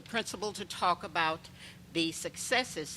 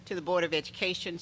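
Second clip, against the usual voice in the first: clearly projected address to the board; speaking up; faster than usual. Real, not acted. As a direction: restart the greeting with more volume. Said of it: "I'll speak with my inside voice".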